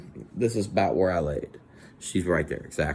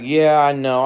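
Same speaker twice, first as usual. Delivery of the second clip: louder, higher blurted apology; 6 semitones higher, 9 dB louder, slower than usual. Retold or imitated. Apologizing to Natalie, loud embarrassed. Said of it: "I'm like".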